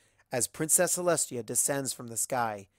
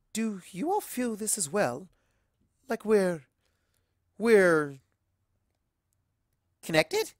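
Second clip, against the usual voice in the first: higher-pitched, searching for the word; tentative question, broken by long pauses; hesitant Rarity voice; 8 semitones higher, 5 dB louder, slower than usual. Acted. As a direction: feel out the others, pausing before the last word. Rarity hesitantly breaks the ice.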